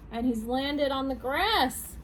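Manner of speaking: plain reading voice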